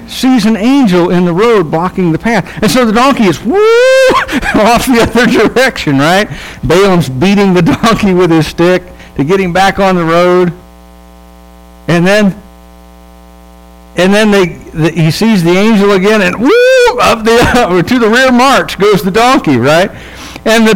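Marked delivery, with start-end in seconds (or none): 3.4-4.5 sudden much higher whoop, laughing into it; high woo of a bolting donkey. Retold or imitated. Donkey bolts from the angel. 16.2-17.0 high woo. Retold or imitated. Donkey bolts again.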